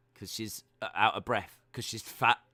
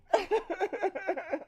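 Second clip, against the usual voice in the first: high laugh. Real, not acted.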